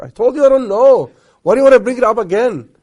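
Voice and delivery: loud, high mock outburst; exasperated wife. Wife asked why she was crying, acted angry outburst. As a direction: snap back like a wife pushed too far. Wife retold snapping at the question.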